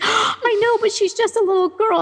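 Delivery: imitating husband, high and pained. Husband fretting to wife about daughter, high and pained. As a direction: whine it like a worried dad over his little girl. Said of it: "He's like".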